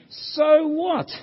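loud, high exasperated outburst; acted exasperation, punching each "so what"; frustrated Solomon. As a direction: throw up your hands in frustration. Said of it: "you can sense Solomon's frustration here".

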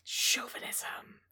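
slow, hushed, breathy aside; whisper. Pretend. Co-host adding a joke word, whispered slowly. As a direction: whisper it like a dark secret.

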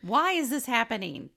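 high, loud wail of disbelief; hammed-up baffled complaint; mock exasperation. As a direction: protest it as if at wits' end. Mock exasperated reply to the film.

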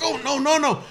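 Travis waving it off, pitched high; a high, protesting no no no; mock protest. Buddy Travis brushing off the fight, high protest. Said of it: "My buddy Travis is like".

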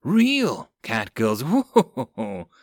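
slow, laughing delight. Acted. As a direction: gush while laughing.

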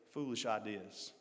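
ordinary talk, nothing marked

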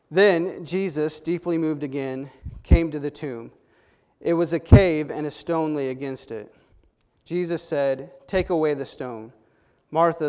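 preacher reading scripture aloud. Acted. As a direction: read the passage aloud to the congregation with weight. Reads John chapter eleven aloud.

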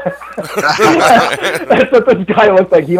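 laughing. Real, not acted. Laughs describing the sheriff.

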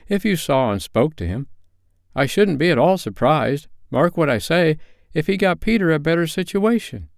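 eager father, pitch climbing as he goes; hopeful Bob. A narrator's reading. Bob tells his wife of Peter's prospects, hopeful. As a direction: build upward in pitch with a father's hope.

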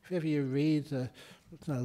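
usual speaking voice

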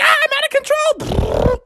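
high, frantic frog cry; hammed-up alarm; acted panic. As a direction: yelp as the ride runs away. Froggy loses control of motorcycle.

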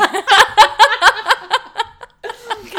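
high loud cackling laugh; loud burst of laughter. Real, not acted. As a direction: cracks up loudly. Big laugh at own joke.

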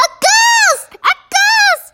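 very high shrieked alarm; panicked yelling. A narrator's reading. Townsfolk seeing an empty dressing gown float, panicked yelling. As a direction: cry out in panic, pitch right up. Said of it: "everybody yelling".

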